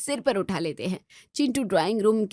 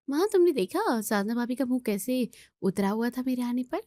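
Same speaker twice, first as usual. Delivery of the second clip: ordinary delivery, nothing marked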